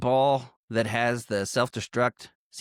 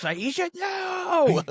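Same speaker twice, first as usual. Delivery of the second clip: much higher, drawn-out slow mock wail of the name; all-caps cry; much slower than usual. Friend acting out the death scene with a slow, high mock wail. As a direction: stretch it out like a dramatic movie cry. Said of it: "I put in all caps".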